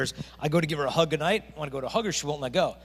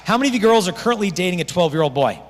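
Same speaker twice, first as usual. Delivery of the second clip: louder, quicker teasing question; loud joking; 3 semitones higher, 8 dB louder than usual. Real, not acted. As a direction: tease the room loudly. Joking question to teen audience.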